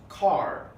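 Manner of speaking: CAR ending in a strong R; strong American R. Shown as a demonstration. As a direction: pronounce the R fully at the end of "car". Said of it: "with a strong R".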